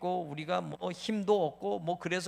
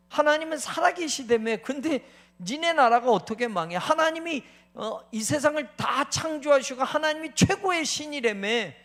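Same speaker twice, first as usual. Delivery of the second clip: mocking jeer; 6 semitones higher, 6 dB louder than usual. Voicing Babylonian mockers.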